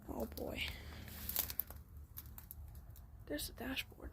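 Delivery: hushed, breathy whisper. Real, not acted. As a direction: whisper it like sneaking in. Sneaking into abandoned truck.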